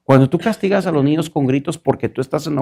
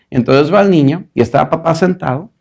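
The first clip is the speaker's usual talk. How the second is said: ordinary talk, nothing marked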